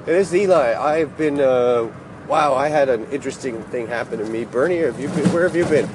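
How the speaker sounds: ordinary delivery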